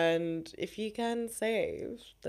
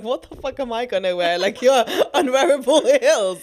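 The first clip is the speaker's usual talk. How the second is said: louder and higher, breaking into laughter; laughing outburst; 5 semitones higher, 10 dB louder than usual. Retold or imitated. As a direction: laugh through the exasperated quote. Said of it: "And I'm like".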